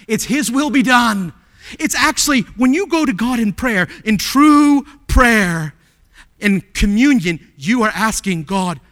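loud, high pulpit insistence; impassioned preaching. Real, not acted. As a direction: hammer it home, stretching key words. Preaches at full force.

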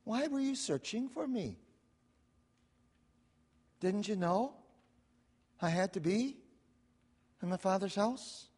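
soft, level, matter-of-fact reply; flat-out answer. Acted. Jesus calmly answering his worried parents, quiet and flat. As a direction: say it quietly, no fuss. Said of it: "this flat-out answer".

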